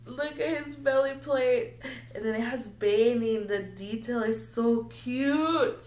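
ordinary delivery

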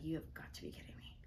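hushed, quick mock disbelief; stunned whisper. Woman retelling her positive test to viewers, stunned whisper.

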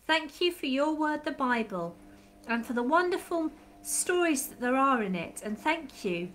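a soft, steady prayer voice; children's prayer. Acted. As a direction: pray gently with the children.

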